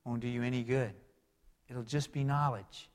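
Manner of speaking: ordinary talk, nothing marked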